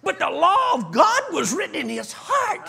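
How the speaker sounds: high, loud preaching cry; preaching shout. Acted. Preacher proclaiming to his congregation, loud and high.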